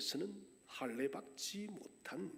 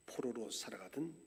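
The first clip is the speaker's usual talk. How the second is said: ordinary delivery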